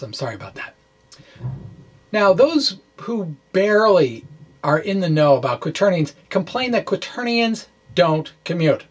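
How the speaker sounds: usual speaking voice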